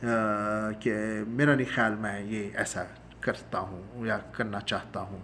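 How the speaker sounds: ordinary talk, nothing marked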